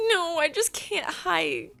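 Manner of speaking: high, drawn-out mock protest; whiny protest. Imitating the scared girl telling him why she's freaking out, high whiny protest. Acting her panicky protest.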